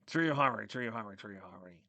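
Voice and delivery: mock pondering, fading away as it repeats; pretending to think. A narrator's reading. Lightning Wing stalling the monster, pretending to think. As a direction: turn the name over as if racking your brain. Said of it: "pretending to think".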